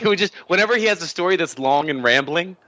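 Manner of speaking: normal talking voice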